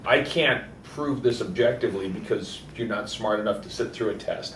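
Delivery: usual speaking voice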